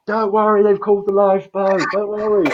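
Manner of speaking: high, reassuring call from the pier; bystander reassuring. Retold or imitated. Onlooker on the pier calling down to the swimmer, high and reassuring. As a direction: imitate a bystander calling out comfort. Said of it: "someone goes".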